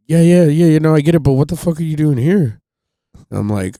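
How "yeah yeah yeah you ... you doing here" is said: higher, louder ranger voice, brushing him off; a ranger who has heard every excuse; park ranger. Retold or imitated. Ranger waves off his excuse, louder and higher. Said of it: "He goes".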